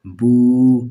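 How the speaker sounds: بو held long and loud; drawn out boo. Shown as a demonstration. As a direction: stretch the oo of بو. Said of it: "الٹا پیش". (کھینچ کر بو)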